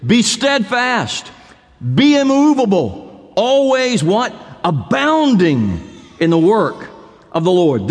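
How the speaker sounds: each phrase drawn out and stressed; measured, weighty scripture reading; slow emphatic recitation. Shown as a demonstration. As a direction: spell the verse out slowly, leaning on every phrase. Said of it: "favorite verse of Scripture is".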